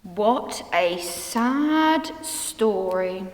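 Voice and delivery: low, very slow and downcast; glum. Acted. Nelson reacting to the story of Jesus' death, glum. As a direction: drag it out, thoroughly let down.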